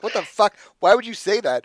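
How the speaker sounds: high, loud, shocked disbelief; baffled outrage. Acted. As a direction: hurl the question back in disbelief. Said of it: "looks genuinely like".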